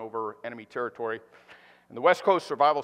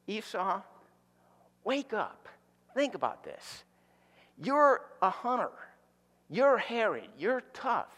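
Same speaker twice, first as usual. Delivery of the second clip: higher, pleading with him to see sense; coaxing Esau; 7 semitones higher than usual. Retold or imitated. Pastor talking to Esau in his head, exasperated coaxing. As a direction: nudge him like a frustrated friend. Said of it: "I read this and I'm thinking".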